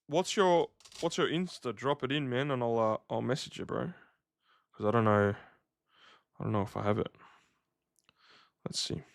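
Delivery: ordinary talk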